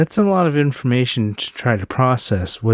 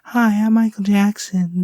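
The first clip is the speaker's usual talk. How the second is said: playful high imitation of a famous singer; 9 semitones higher than usual. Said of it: "like the [name] tone".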